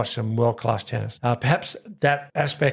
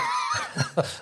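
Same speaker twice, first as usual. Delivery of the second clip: burst of laughter; 5 dB quieter than usual. Real, not acted.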